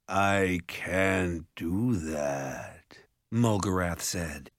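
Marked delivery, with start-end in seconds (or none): 0.1-3.0 very slow monster drawl; ogre voice. A narrator's reading. Ogre refusing Jared's plea, slow monster voice. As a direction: draw every word out like an ogre.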